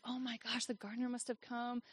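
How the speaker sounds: softly, to herself, as it dawns; quiet realization. Retold or imitated. Realizing the gardener pruned it, a soft dawning murmur. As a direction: murmur the realization under your breath. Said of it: "it, like, dawns on me".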